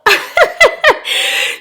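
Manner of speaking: high breathless burst of laughter; real tickled laugh; high airy laugh. Real, not acted. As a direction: laugh high and unvoiced at the accent. Laughing at the accent.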